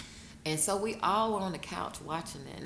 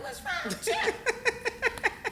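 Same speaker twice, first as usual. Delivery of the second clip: much higher, slow, through laughter; laughing mock question; 5 dB louder, much slower than usual. Widow laughing at her daughter's puzzled question. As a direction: squeal the question then laugh. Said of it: "I mean, like".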